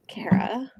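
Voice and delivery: normal talking voice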